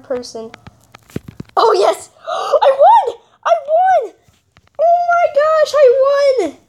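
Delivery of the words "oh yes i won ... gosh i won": high shrieked victory cries; shrieking jackpot winner. Real, not acted. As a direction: scream with joy at winning. Wins the game.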